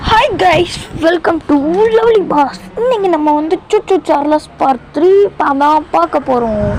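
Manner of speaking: high-pitched mimicked YouTuber greeting; Lovely Boss intro imitation. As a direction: copy a vlogger's punchy high opener, settling as it goes. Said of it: "நல்லா சொன்னேன்னு நினைக்கிறேன்".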